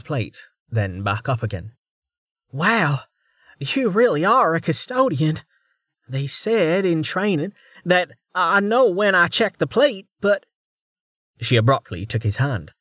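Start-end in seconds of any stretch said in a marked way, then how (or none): 2.5-10.4 put-on higher, eager young officer; bright-eyed junior officer voice; young woman voice. A narrator's reading. Female officer's voice.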